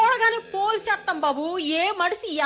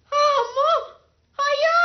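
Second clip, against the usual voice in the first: wailing cry; 9 semitones higher than usual. Acted. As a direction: cry out in grief. Woman wailing at the death.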